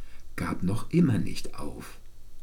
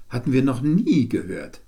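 usual speaking voice

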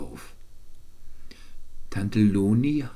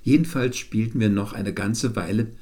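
usual speaking voice

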